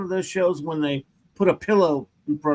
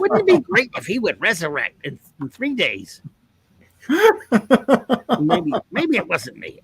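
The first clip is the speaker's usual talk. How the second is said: swooping, self-mythologizing showman; grand old comic-book huckster, pitch rolling up and down; boastful pitchman taking credit for everything; Stan Lee; 3 semitones higher than usual. Acted. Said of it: "Stan Lee, I present Resurrection".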